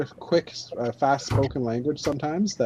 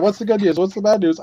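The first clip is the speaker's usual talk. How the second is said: louder, higher repetition for someone who missed it; emphatic repeat; 5 semitones higher, 5 dB louder than usual. Real, not acted. Repeats himself after listener's "huh?.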